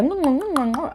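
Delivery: high mocking mm sounds; mock clicking noises. Kids imitating movie Africans, high mock mm sounds. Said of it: "they were like".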